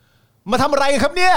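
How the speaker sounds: high, loud and slow mock shout with a laugh; pretend rally shout. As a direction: belt "มาทำอะไรกันครับเนี่ย" like from a rally stage, laughing. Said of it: "ผมจะตะโกนว่า". (ตะโกนเสียงดังแบบแกล้งบนเวทีปราศรัย)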